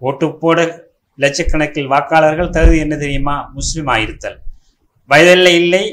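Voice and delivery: emphatic, punched verse delivery; forceful poem declamation. Acted. Poet proclaiming who the voters are, forceful declamation. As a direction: proclaim each line to the crowd.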